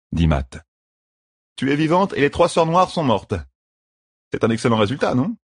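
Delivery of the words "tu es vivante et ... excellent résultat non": higher-pitched, lightly voiced rogue; upbeat rogue. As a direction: sum it up optimistically. Voices Matt summing up.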